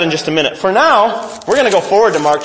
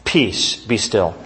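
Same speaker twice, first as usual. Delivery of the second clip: deep command; 8 semitones lower, 5 dB quieter, slower than usual. Acted. Quoting Jesus calming storm.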